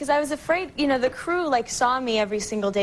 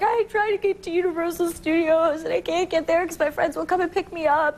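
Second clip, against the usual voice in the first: higher-pitched imitation of a crying girl; mock bawling; 5 semitones higher than usual. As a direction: wail it out like someone retelling a sob story. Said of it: "bawling her eyes out".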